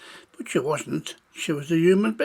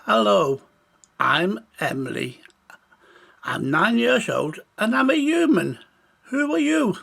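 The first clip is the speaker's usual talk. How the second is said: lightly voiced little girl, higher at the end; a hint of a child's voice, pitch climbing; young girl introducing herself; 5 semitones higher, 4 dB louder than usual. A narrator's reading. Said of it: "She spoke in to his ear and said".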